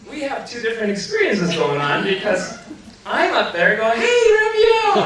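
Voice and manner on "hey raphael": high, loud calling shout from above; shouting her name. Retold or imitated. Out-of-body man yelling down to his wife, laughing shout. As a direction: yell down to her with a laughing cry.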